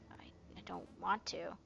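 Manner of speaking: frightened whispered refusal, stammering; terrified child whispering refusal; scared whisper. Acted. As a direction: whisper it, too scared to act.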